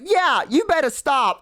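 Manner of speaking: high, slow mock warning; retold defiant warning. Goblin warning the orcs to stop, defiant.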